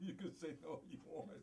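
high laughing words. Real, not acted. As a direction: laugh it out at her. Laughs at co-host's refusal.

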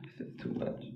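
frightened whisper. Real, not acted. Streamer whispers after showing Jeff's picture. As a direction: hush it, overwhelmed.

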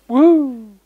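high, loud woo; excited cheer. Real, not acted. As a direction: whoop with delight. Cheering before going out.

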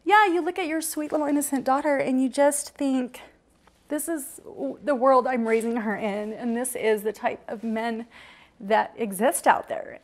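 high, wavering with held-back tears; pushing on through tears, slowing at the end; emotional. Real, not acted. Mother thinking of her sweet daughter, fighting tears. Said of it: "I hate getting emotional".

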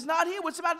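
high, urgent voice over the commotion; urgent reply. Retold or imitated. Angel to the screaming kid at the door, urgent.

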